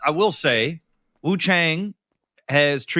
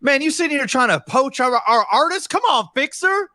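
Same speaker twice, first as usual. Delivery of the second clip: excited; 14 semitones higher, 5 dB louder than usual. Acted. Excited greeting of a rival.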